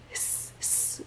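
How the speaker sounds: unvoiced snake hiss; a long soft snake hiss. Shown as a demonstration. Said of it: "hiss our snake around".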